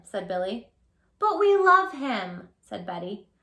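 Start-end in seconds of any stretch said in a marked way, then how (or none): 1.2-2.5 put-on pleading child. Betty voiced in story.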